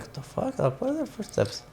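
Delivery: breathless whispered alarm; scared whisper. Retold or imitated. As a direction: whisper it so no one hears. Retelling hearing footsteps at night.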